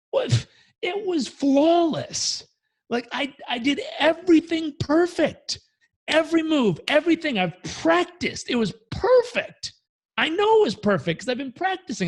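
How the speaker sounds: high-pitched, stunned protest, slow on flawless and perfect; indignant student. Retold or imitated. Student to the master, a high, disbelieving protest. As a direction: whine it like a student who can't believe he failed.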